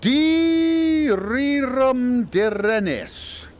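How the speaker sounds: spell incantation. Acted. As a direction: intone the spell like a wizard.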